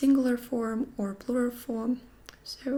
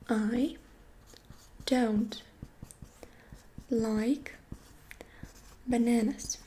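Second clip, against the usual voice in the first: each word set apart with pauses; slow word by word; slower than usual. Shown as a demonstration. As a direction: say it slowly for the learner.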